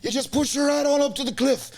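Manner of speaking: high hammed-up Irish lad voice; high mock Irish lad coaching. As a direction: explain it like a drunk Irish mate, high. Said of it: "'cause it's all cliffs".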